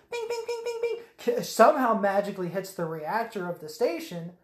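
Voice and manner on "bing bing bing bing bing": very high, soft pinging; toy-like high bing noises; imitated blaster pings. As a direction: chirp out rapid little zaps. Imitates ship firing.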